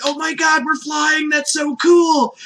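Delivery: high excited shout; loud and delighted; thrilled Goku. Retold or imitated. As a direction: yell it like a kid on a thrill ride. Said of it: "loves it".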